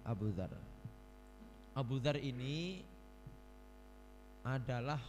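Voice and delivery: ordinary talk, nothing marked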